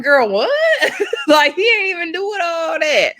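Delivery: high, loud disbelief breaking into laughter; incredulous reaction. Retold or imitated. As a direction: react loudly, pitched right up, half laughing. Her shocked reaction to the quarrel.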